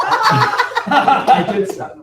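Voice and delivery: slow laughing admission, high and loud. Real, not acted. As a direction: laugh through the words. Laughing at teasing.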